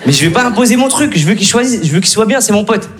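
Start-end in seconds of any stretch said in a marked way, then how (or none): none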